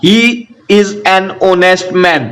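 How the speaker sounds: is an honest man drawn out very slowly, each word separate; very slow classroom reading of is an honest man; slow dictation. Shown as a demonstration. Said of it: "यहाँ पे एच क्या है? Silent है".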